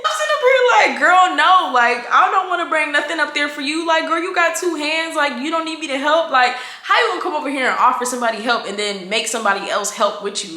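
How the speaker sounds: high, laughing exasperation; amused outrage at a lazy coworker; laughing disbelief. Retold or imitated. Inner reaction to coworker request.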